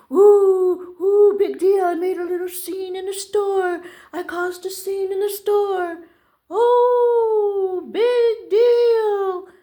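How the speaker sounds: high, loud, drawn-out mock alarm; sarcastic singsong scoffing; mocking dismissal. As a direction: ridicule the fuss with wailing oohs. Mocking fuss over making a scene.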